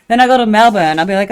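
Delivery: ordinary talk, nothing marked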